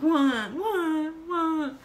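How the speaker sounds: high, loud whimpered questions; hammed-up tearful bewilderment; mock crying. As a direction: blubber each word in confusion. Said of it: "I'm just crying and crying".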